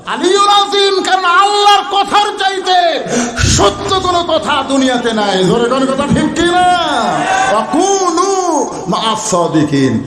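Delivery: high, chanted call to the crowd; full-force preaching. Real, not acted. Preacher rallying the crowd for the Quran, full-force chanted preaching.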